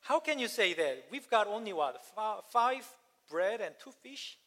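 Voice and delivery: high, loud incredulous outburst that eases down; disbelief. Retold or imitated. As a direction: protest as if the order is impossible. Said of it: "And the disciples said".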